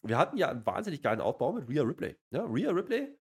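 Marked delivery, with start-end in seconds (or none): none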